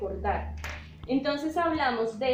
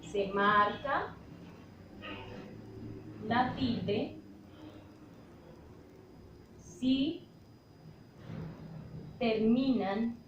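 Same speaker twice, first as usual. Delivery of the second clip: very slow, word groups with long pauses; slow dictation; 7 dB quieter, slower than usual. Real, not acted. Teacher dictating the tilde rule to students, slow dictation. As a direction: dictate it bit by bit, drawing out the words.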